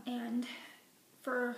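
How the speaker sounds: ordinary talk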